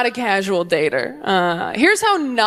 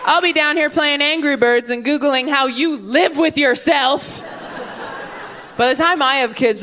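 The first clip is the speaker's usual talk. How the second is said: ordinary talk, nothing marked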